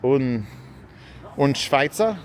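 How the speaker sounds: mock German babble; half-hearted fake German accent. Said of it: "I start speaking fake German".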